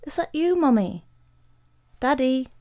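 put-on small high-pitched boy calling into the dark; frightened, half-awake little questions; child's uncertain mumble. A narrator's reading. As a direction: ask it tentatively, as a woken child. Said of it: "Freddy mumbled".

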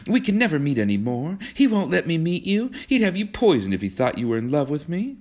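high-pitched reproach; cross. A narrator's reading. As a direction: complain crossly, pitch climbing. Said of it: "said Kismine crossly".

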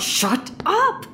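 exasperated silencing. Acted. Silencing partner during a bluff.

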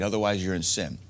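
ordinary talk

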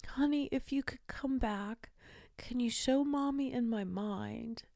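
soft, high, gentle request to a beloved dog; hushed, affectionate asking; tender pleading. Retold or imitated. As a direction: ask softly, as if to a dear pet. Asks dying dog a favour.

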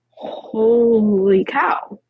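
drawn-out slow stunned exclamation; slow, disbelieving holy cow. Retold or imitated. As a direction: let it sink in, slowly. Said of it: "And I was like".

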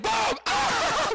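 high, gleeful "Boom!" then a joyful cry. Retold or imitated. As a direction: celebrate it like a win. Arm moves freely again.

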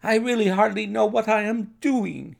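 high-pitched, flustered outburst; distraught cry. A narrator's reading. Overwrought doctor in his waiting room, high distraught cry. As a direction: cry it out at your wits' end. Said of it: "he cried".

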